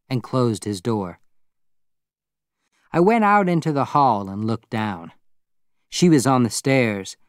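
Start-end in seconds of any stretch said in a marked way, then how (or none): none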